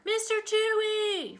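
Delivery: high, drawn-out call to a cat; high-pitched searching call. A narrator's reading. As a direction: sing out the pet's name, long and high. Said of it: "Freddie called".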